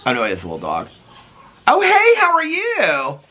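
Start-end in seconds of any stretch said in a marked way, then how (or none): 1.7-3.2 much higher, chirpy neighbour chat; friendly greeting. Real, not acted. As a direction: call out brightly to a passing neighbour.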